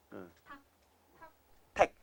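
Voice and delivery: ordinary talk, nothing marked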